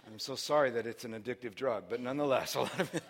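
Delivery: usual speaking voice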